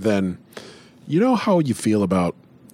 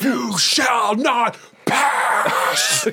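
booming wizard roar, high and loud; Gandalf shout; 13 semitones higher, 5 dB louder, much slower than usual. Acted. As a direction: bellow like Gandalf on the bridge. Gandalf quote after cheese bog.

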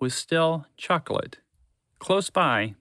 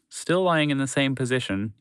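ordinary delivery, nothing marked

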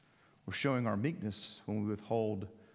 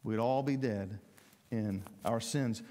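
ordinary talk

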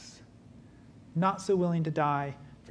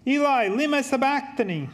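higher, louder anguished cry of Eli; a raised, pleading cry to God; 7 semitones higher, 3 dB louder than usual. Acted. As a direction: call it out as if from the cross. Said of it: "cried out with a loud voice".

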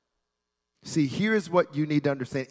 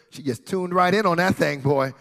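normal talking voice

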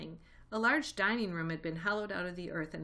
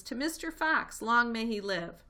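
loud, high cheer; shouted toast; 4 semitones higher, 6 dB louder than usual. A narrator's reading. The animals cheer Mister Fox, loud and high. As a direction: cheer it out to the hero. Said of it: "they all shouted".

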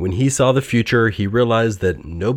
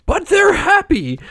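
very high, louder protest; Scrooge; 5 dB louder than usual. A narrator's reading. Old miser protests to phantom at couple's joy, high loud dismay. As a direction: object in a voice pitched far up. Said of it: "said Scrooge".

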